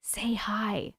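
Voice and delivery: whispery mock voice; imitated whisper. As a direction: mimic someone whispering, dropping the volume. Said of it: "sounds like someone whispering".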